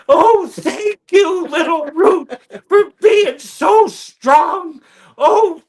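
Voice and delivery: very high, slow, loud, teary gushing thanks; Chris Farley. Retold or imitated. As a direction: blubber thanks through laughter and tears.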